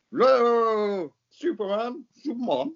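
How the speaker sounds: high, drawn-out villain call. Acted. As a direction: call out to Superman theatrically. Villain calling out to Superman.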